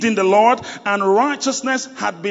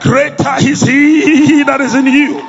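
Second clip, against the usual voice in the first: rally speech; 5 semitones higher, 4 dB louder than usual. Acted. Preacher rousing the congregation.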